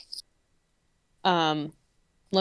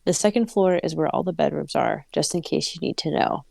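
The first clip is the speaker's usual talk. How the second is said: story narration; 4 semitones lower than usual. Acted. Reads the story aloud.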